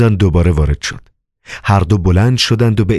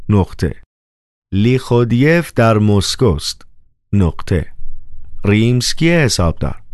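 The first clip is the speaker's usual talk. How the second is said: dictating over phone; 5 semitones higher than usual. A narrator's reading.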